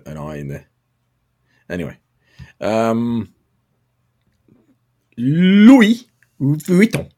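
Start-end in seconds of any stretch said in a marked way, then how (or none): 5.2-7.1 Louis Vuitton drawn out slowly, French style; Chinese way of saying it. Shown as a demonstration. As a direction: say Louis Vuitton slow and careful. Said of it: "You know how Chinese say Louis Vuitton?".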